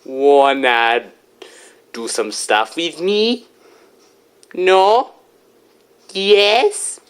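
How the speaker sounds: a hammed-up, badly faked Australian accent; mock Australian flirting, overdone; cheesy fake Australian voice; terrible Australian accent. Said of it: "enough of that accent".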